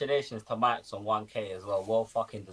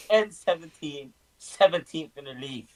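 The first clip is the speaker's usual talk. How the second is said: usual speaking voice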